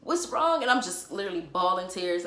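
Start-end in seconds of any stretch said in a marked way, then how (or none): 0.0-0.6 high, loud worried "what's wrong"; anxious best friend pleading; frantic friend. Retold or imitated. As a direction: mimic the panicked friend asking over and over. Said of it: "She's like".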